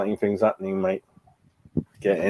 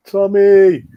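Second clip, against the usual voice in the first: calling out; 9 semitones higher, 7 dB louder than usual. Real, not acted. Calling out to Tommy.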